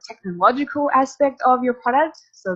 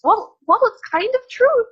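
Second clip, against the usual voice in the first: sudden high, louder playful concession; playful hedge; 5 dB louder than usual. Real, not acted. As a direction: tease it as half true, jumping up in pitch. Hedging her own claim.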